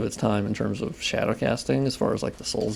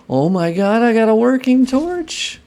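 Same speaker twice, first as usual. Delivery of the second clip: high, loud and slow with delight; excited shriek; 11 semitones higher, 6 dB louder, slower than usual. Real, not acted. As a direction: squeal it out like a jackpot win. Finds working torch in game.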